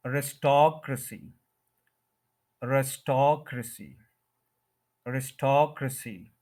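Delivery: "अरिस्टोक्रेसी" as a-ris-TOK-ruh-see; third-syllable stress. Shown as a demonstration. Teacher modelling for learners, stress on the third syllable. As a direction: lengthen TOK in "अरिस्टोक्रेसी", short ruh after it. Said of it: "स्टॉक के ऊपर stress है".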